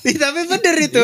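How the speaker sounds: high squealing laugh into speech. Real, not acted. Cohost laughs high at the bypass joke.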